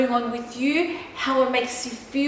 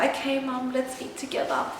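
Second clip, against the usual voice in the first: softer, half whispered, nervous; timid whisper; 6 dB quieter than usual. Retold or imitated. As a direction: shrink down and barely say it. Nervously agreeing with mother-in-law.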